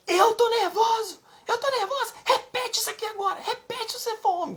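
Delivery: high screeching challenge, acted out; imitated furious shout. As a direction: mimic an enraged wife yelling at her husband. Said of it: "Deu um grito com ele".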